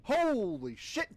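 high, drawn-out shocked yelp; jolted, stretched-out exclamation; startled outburst. Real, not acted. Startled by game scare.

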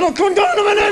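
high, loud panicked shouting through laughter; panicked yell. Retold or imitated. Aerial fella shouts down at mum from the ladder, high and loud. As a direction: yell down while hanging off the gutter.

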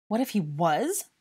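loud, slow, rising echo question; disbelief. A narrator's reading. Dennis echoes Harry's words back, in disbelief. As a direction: repeat it back, incredulous. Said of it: "Dennis said in disbelief".

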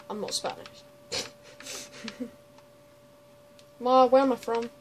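usual speaking voice

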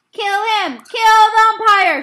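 enraged spectator screaming at the umpire; furious crowd shout. A narrator's reading. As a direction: bellow it like an angry fan. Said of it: "shouted someone in the stand".

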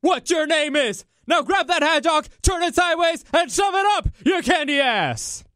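high, shouted wrestler tirade; The Rock. Acted. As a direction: bellow like a wrestler cutting off an answer. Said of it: "The Rock would have been like".

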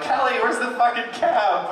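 put-on high, wobbly drunk-girl whine; mock tipsy yell for Kelly. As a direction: call for your friend while stumbling. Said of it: "like a newborn calf".

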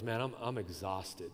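acted weariness, low and soft; exhausted. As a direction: let it sag, drained and quiet. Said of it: "Like, in other words".

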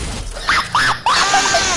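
high unvoiced shriek of laughter. Real, not acted. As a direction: cackle up high and loud.

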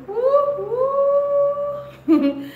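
high, loud cheering woo; loud whooping over the new light; excited whoops. Real, not acted.

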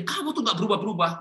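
high-pitched scolding parent; nagging reproach. Shown as a demonstration. Parent faulting a child for never changing, nagging reproach. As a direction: demonstrate a parent's high, exasperated nag. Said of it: "Jangan begini".

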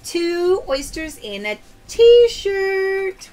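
host revealing the haul to Jessica; excited slow announcement. Real, not acted. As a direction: stretch the prize out with a cheer.

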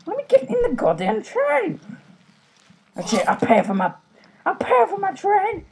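squeaky frustrated ranting; high-pitched rant. Real, not acted. Ranting at the game train.

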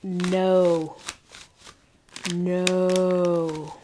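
low, drawn-out "No, no"; lightly voiced scolding nerds; mock nerds saying no. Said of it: "the other nerds ... said".

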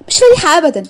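friend's high indignant cry; exclaiming. Acted. Friend scolding the sad Fariha.

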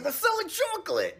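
high, shouted character voice; imitating her voice. Shown as a demonstration. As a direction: cry it out in a caricature of her. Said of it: "Does that not sound like her?".

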